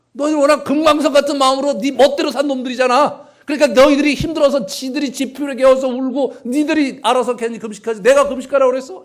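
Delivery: loud high scolding rant; angry tirade. Pretend. Preacher voicing God berating stubborn people, loud and angry. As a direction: let God's built-up anger burst out. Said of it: "이렇게 말씀하시죠".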